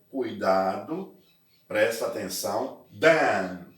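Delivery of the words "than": "than" said with an open a, "dan". Shown as a demonstration. Teacher contrasting than with then, open vowel. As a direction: give "than" the open a sound. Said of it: "pronúncia de E mesmo".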